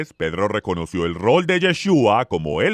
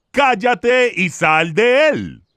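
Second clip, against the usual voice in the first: slow, higher-pitched acted command; stern rebuke; 6 semitones higher, slower than usual. A narrator's reading. Yeshua casts out a demon, rebuking slowly. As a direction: rebuke it with drawn-out authority. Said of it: "lo reprendió diciendo".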